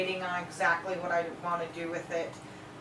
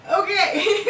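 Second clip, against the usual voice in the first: shocked, higher, louder laugh; 11 semitones higher, 8 dB louder, slower than usual. Real, not acted. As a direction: laugh out a scandalised no you didn't.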